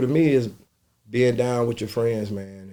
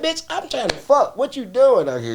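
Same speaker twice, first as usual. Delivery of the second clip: dismissive retort; 5 dB louder than usual. Retold or imitated. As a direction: shoo off the groupie with a laugh. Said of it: "I'm like".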